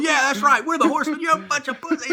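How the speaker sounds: high, loud mocking jeer; taunting yell. Wrestlers taunting the heels, loud and laughing. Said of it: "they're laughing, going".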